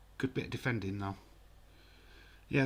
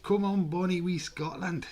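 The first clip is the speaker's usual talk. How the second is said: high put-on Scottish accent, cheering; Scottish accent cheering on wee Scotland; 7 semitones higher, 4 dB louder than usual. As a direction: ham up a Scottish brogue to cheer them on. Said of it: "we will not be doing that accent".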